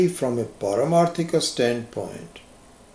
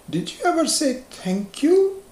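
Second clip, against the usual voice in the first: put-on parent prompting child. Parent prompting child after candy.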